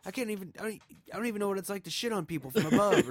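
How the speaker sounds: high-pitched comic griping; mock indignation. Voicing Turkey answering the seagull's taunts, mock indignation. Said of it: "I'm pissed I can't fly".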